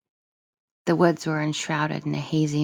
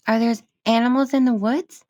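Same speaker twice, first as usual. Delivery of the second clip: put-on high, bright child asking; a small girl wondering aloud; little girl voice; 7 semitones higher, 6 dB louder than usual. Child voice Lilica.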